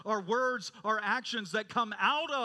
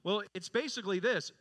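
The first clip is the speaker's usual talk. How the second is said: ordinary talk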